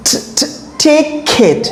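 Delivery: very slow, ট sounded out before ticket; slowed breakdown. Shown as a demonstration. Teacher slowly splitting ticket for students. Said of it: "এটা কি হবে?".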